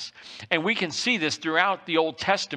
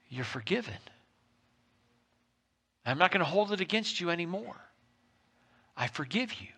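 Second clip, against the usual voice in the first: tender forgiveness; 6 dB quieter, faster than usual. Retold or imitated. God speaks forgiveness.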